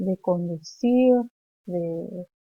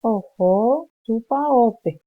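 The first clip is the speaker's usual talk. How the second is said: slow, loud, carefully drawn-out teacher model; slow teaching demo; slow model phrase; 2 semitones higher, 6 dB louder, much slower than usual. Shown as a demonstration. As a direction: spell it out slowly for the class to repeat.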